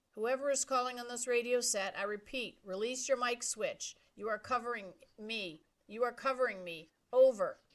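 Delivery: radio operator. A narrator's reading. Radio operator answers Brian.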